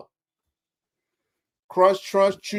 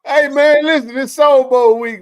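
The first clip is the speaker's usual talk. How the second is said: louder, higher hype-man call; hyped announcement; 8 semitones higher, 10 dB louder than usual. Real, not acted. Co-host announcing Soul Bowl week to listeners, hyped. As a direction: pump up the crowd.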